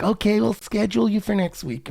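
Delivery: high, chirpy put-on receptionist voice. Clinic receptionist booking the next week, in a high put-on voice.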